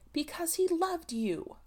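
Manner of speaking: a mild stammered admission to her cousin. A narrator's reading. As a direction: force the words out haltingly. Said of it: "Charlotte Lovell stammered out".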